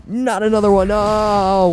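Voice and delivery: high loud exasperated cry; dismayed yelp. Real, not acted. Gamer facing another enemy, dismayed cry.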